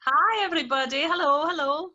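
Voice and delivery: normal talking voice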